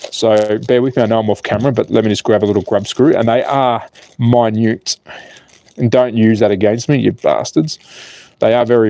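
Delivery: normal talking voice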